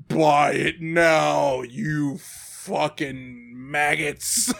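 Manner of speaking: slow, booming commands to the masses; a domineering overlord barking orders; booming arrogant alien overlord. Pretend. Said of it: "continues mock console voice".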